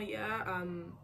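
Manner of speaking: slow, laid-back English answer in a lightly put-on voice; easygoing example answer, slowed down; casual English reply. Shown as a demonstration. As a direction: drawl out a relaxed chatty reply. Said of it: "tu peux répondre genre".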